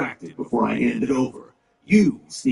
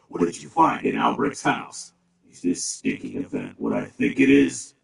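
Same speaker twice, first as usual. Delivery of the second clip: usual speaking voice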